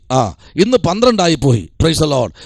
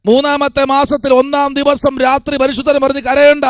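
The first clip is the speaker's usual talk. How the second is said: building preaching; 5 semitones higher than usual. Real, not acted. Preacher tells the weeping their prayers are answered, building impassioned preaching. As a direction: start measured, climb into high sermon force.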